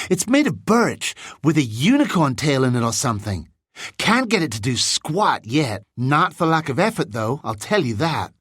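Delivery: put-on lively boy, pitched a little up; chatty American boy. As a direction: show off ruefully in a boy's voice. Zane shows off his wand.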